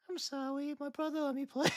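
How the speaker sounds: high, soft little voice; childlike mock apology; put-on apologetic voice. Shown as a demonstration. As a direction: squeak out a meek excuse. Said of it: "I don't do that voice, but I say, like".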